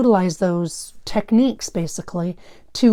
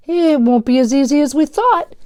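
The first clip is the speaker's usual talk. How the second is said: grumbling; 6 semitones higher, 6 dB louder than usual. Acted. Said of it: "Daniel grumbled".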